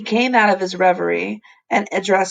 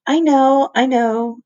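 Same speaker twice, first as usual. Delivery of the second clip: fibbing; 6 semitones higher, 3 dB louder, slower than usual. A narrator's reading. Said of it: "she fibbed".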